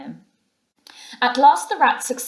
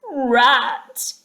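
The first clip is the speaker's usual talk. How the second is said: moaning; 3 semitones higher, 7 dB louder than usual. A narrator's reading. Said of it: "he moaned".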